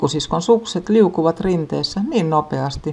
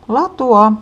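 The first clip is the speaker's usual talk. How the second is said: latua called out higher and loud; higher shout; 8 semitones higher, 6 dB louder than usual. A narrator's reading. Big sister calling again, more urgently. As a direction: push the second latua up high. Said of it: "isosisko huutaa".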